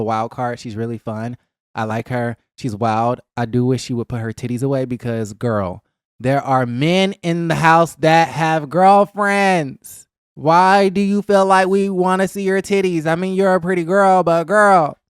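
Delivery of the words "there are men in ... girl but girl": wag a finger at her with your voice; sassy scolding. Pretend. Vlogger scolding Shanice for flashing the men, sassy and loud. Scolding a cast member directly.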